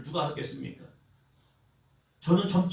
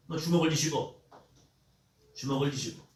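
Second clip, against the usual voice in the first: normal talking voice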